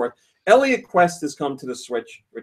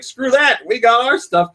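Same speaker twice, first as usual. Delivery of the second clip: cocky company voice; 10 semitones higher, 4 dB louder than usual. Retold or imitated. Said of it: "Nintendo's like".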